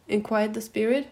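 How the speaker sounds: ordinary delivery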